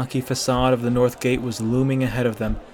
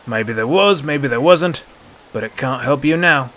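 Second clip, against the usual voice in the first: a touch of a sneer, a bit louder; 5 dB louder than usual. A narrator's reading. Second guard taunting the boy, lightly sneering. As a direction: taunt him, mildly mocking. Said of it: "the other guard sneered".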